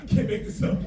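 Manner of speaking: breathless laughing squirrel; whispery giggling critter. Retold or imitated. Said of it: "the squirrel looks me in the eye and says".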